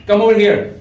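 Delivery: loud, high, commanding call; stern tone. Shown as a demonstration. Teacher demonstrating the other tone on a kid, stern and loud. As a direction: order the child over sharply. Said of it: "that's other tone".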